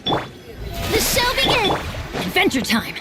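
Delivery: very high, ringing call to adventure; high-pitched rallying shout; excited battle cry. Acted. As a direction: launch into the fight with glee. Game character battle cries.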